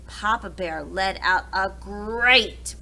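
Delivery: ordinary delivery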